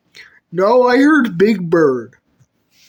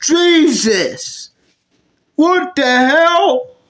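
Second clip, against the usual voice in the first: shocked; 3 semitones higher, much slower than usual. Acted. Friends shocked at Big Bird.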